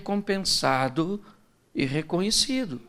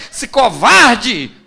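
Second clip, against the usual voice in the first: slow, louder laughing mock outburst; furious wife; 3 semitones higher, 11 dB louder, much slower than usual. Wife exploding at her lying husband, mock furious. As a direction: spit out the insult. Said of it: "Aí é que ela fica louca".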